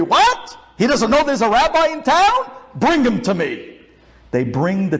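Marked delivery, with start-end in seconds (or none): 0.0-3.8 high-pitched outraged disbelief; indignant cry turning to a command; angry rabbi. Acted. Rabbi hears of a rival in town, angry. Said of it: "The rabbi gets angry".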